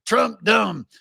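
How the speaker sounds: high, simplistic mock slogan; mock crowd taunt. Democrats trying the old line after the election, mock taunt. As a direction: chant it like it always worked. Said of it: "they go".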